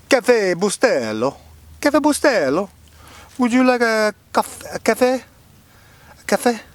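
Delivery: high, loud hammed-up Italian guy; bad Italian accent. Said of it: "Rob Schneider doing an Italian guy".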